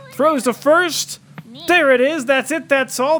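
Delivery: triumphant final-out call; loud joyful win call; excited. Real, not acted. Final out, game won.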